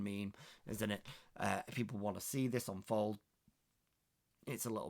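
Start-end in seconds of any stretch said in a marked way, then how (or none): none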